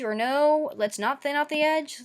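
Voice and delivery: ordinary talk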